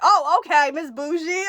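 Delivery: sassy mock-offended quip; teasing sass. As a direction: tease her like a diva. [name] teasing her.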